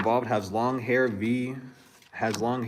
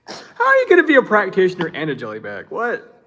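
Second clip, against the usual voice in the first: louder, pitch up, scoffing question; mocking disbelief; 8 semitones higher, 8 dB louder than usual. Real, not acted. Reader mocking the male practitioner, scoffing.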